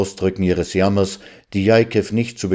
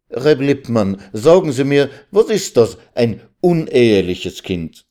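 mildly urgent, higher-pitched questioning; sudden calling out; 6 semitones higher than usual. Acted. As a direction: break in abruptly, pressing for an answer. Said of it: "Mit einem Male rief sie". (plötzlich fragend ausrufen)

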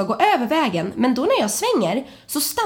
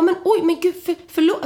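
flustered apology; 7 semitones higher than usual. Retold or imitated. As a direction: blurt a startled, stammered apology.

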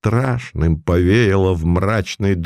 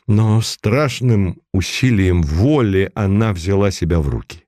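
usual speaking voice

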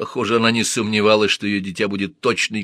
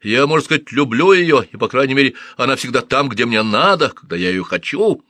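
raised, louder pleading voice; heated arguing; 5 semitones higher, 5 dB louder than usual. Acted. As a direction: push back hard, voice raised. Thutmose argues heatedly.

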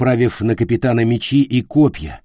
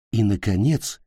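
usual speaking voice

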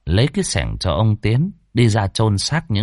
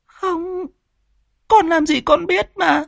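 put-on much higher, tearful teenage girl pleading with her father; whimpering schoolgirl. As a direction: plead like a frightened young girl, voice raised high. Daughter answers father.

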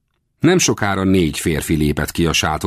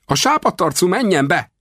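high-pitched shouted command, acted in narration; barked order; 13 semitones higher than usual. A narrator's reading. Second guard ordering the paleface, high-pitched yell. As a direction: cry out the order far above usual pitch. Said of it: "rivalt rám ez".

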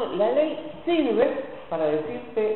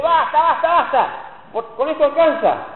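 high, loud panicked "basta, basta"; terrified pleading; 8 semitones higher, 5 dB louder than usual. Retold or imitated. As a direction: beg it to stop, trembling with awe. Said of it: "y dice".

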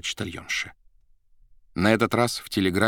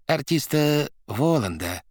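sweetly asking; 7 semitones higher than usual. A narrator's reading. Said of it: "сладко спросил Варенуха".

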